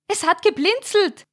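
high, shrill cry of fright; frightened scream. A narrator's reading. Verena crying out in terror at the blinking baby figure. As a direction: shriek it in terror. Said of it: "Da schrie Verena laut auf".

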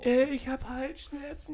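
high, slow, whiny fake-sick voice. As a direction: pretend to be sick, whining. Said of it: "Komm, wie hört sich das denn an?".

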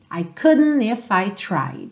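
ordinary talk

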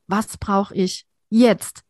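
slow question, jetzt leaned on hardest; stress on jetzt. Shown as a demonstration. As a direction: draw it out and land on jetzt. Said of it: "noch mal anders betonen". (langsam, Betonung auf „jetzt“)

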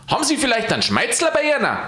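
high, fast shouted request; calling out. A narrator's reading. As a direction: fire off the question in a high voice. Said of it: "schrie Aloysius".

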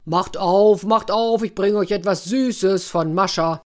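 loud bear calling at the door; shouted bear call to the house. Acted. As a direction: bear pounds and calls for them to open.

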